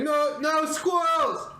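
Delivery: high, slow drawn-out yell; shout. Retold or imitated. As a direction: stretch the cry out high. Quoting the shout again.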